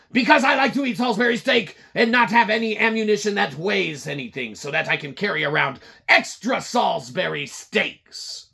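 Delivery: high, loud, fast yes-man; a marketing flunky answers the boss's red phone, high and loud; eager underling. Retold or imitated. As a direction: snap to attention like a nervous employee. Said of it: "Marketing's there".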